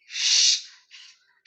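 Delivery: श- as a bare unvoiced hiss of air; teacher showing the sh airflow; voiceless sh. Shown as a demonstration. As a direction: push air through श- without voice. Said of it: "थोड़ा हवा निकलना चाहिए".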